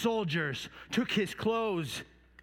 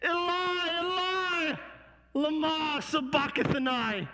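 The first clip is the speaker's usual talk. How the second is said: raised anguished cry, slowing on the last words; crying out loud; 7 semitones higher, slower than usual. Acted. Jesus on the cross crying out to God, raised and anguished. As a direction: call out to heaven in anguish. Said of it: "he cried out in a loud voice".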